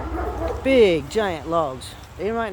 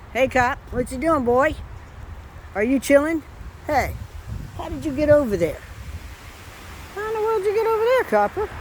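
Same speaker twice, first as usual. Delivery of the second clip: pet talk; 10 semitones higher than usual. Real, not acted. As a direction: squeal at the clever dog in surprise. Cooing at the dog Copper.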